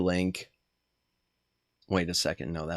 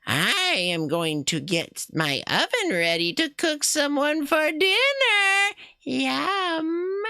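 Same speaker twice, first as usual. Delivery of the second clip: much higher, slow witch voice; 12 semitones higher, slower than usual. Acted. Streamer voicing the witch planning to cook someone. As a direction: gloat over the dinner like a witch. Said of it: "The witch mutters".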